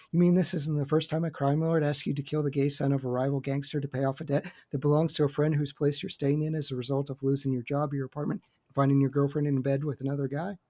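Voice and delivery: fast, run-on imitation of a manic reply; manic one-breath recap. Retelling Lindsay's response to Slevin, manic and fast. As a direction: rattle it off without a pause. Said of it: "has this very manic response".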